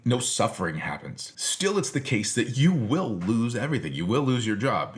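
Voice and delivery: normal talking voice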